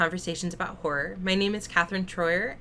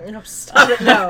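shocked laughter; 3 semitones higher, 14 dB louder than usual. Real, not acted. Cohost groans at the pun.

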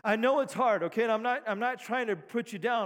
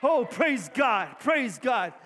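slow, shouted and cracking with joy; real preacher's joy cracking the voice; voice cracking with excitement; much slower than usual. Real, not acted. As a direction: let the voice break from excitement. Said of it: "I'm getting excited when my voice cracks".

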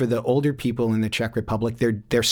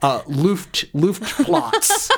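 flustered broken German; 7 semitones higher, much slower than usual. Retold or imitated. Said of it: "all of my German goes out the window".

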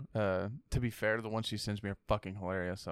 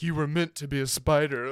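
louder, odd solemn voice; mock mystic; 3 semitones higher, 6 dB louder than usual. Friend imitating Madam Web greeting Peter, mock solemn. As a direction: proclaim it like a prophecy. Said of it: "she's like".

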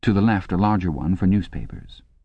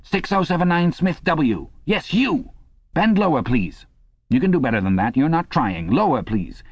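high-pitched, scolding instructress; shrewish telescreen voice; 9 semitones higher than usual. A narrator's reading. Instructress scolds a slacking man by number, shrewish and high. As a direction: order him about in a high shrewish voice. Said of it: "screamed the shrewish voice from the telescreen".

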